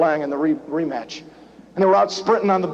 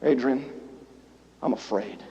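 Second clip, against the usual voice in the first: low, very soft, near breaking; Rocky admitting fear to his wife; tearful confession; 8 semitones lower, 11 dB quieter than usual. Retold or imitated. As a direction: confess it almost in tears. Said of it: "Rocky kinda tears up".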